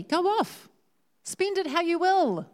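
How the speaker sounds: high, airy send-off; high-pitched carefree indulgence; breezy permissive father. Retold or imitated. Father waves son off with money.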